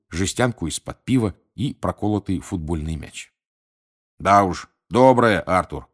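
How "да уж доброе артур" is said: weary, grumbling reply; tired grumble. A narrator's reading. Basil answers Arthur after a night on duty, tired grumble. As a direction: grumble it back with a touch of tiredness. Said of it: "устало пробурчал Безил".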